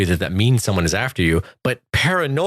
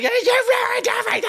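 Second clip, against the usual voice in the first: much higher, fast mock yell; mock shriek; faster than usual. Retelling the woman attacking Will, high mock shriek. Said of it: "she's like".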